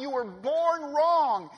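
loud, high, very slow, hanging on wrong; slow preached cry. Acted. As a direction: hammer it slowly like a verdict. Preaching climax, slowed.

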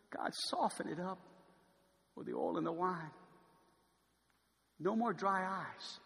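soft, low pleading to God; hushed prayer. Acted. Preacher praying quietly for a softened heart, hushed. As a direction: pray it softly, almost to yourself.

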